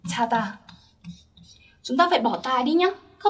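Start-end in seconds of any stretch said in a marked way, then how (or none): 0.1-0.6 whispered example word. Shown as a demonstration. Teacher whispering the example to students. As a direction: hush the word to the class. Teacher models form softly.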